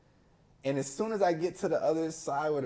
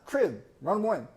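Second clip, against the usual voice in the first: slow, higher, louder coach call; 7 semitones higher, 6 dB louder, slower than usual. Retold or imitated. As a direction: call the play across the court. Coach calling the play.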